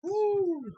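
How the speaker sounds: high, loud "woo"; excited whoop. Real, not acted. Watching the show start with a bang, excited whoop.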